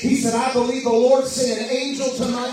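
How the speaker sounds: fervent revival preacher building his voice to the climax; preaching at full force. Acted. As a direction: preach it out, rising to a roar.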